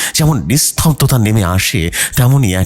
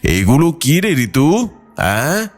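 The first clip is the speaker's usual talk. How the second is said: lightly voiced puzzled father; a father's curious question, a little higher and slow; father asking; 6 semitones higher, slower than usual. A narrator's reading. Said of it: "শ্যামল জিজ্ঞেস করলেন". (মেয়েকে প্রশ্ন করা বাবা, হালকা কৌতূহলী স্বরে)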